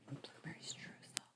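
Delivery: hushed, breathless whisper of a guess. Real, not acted. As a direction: whisper it so the others can't hear. Secretly whispering her guess.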